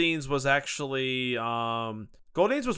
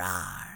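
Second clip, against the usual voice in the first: low, softer rawr; playful monster growl; 9 semitones lower, 8 dB quieter than usual. Pretend. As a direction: drop the voice low for a better growl. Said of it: "let me do a better one".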